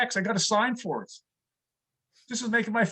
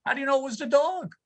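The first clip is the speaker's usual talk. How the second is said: wife's jab; 5 semitones higher than usual. Retold or imitated. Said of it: "My wife says".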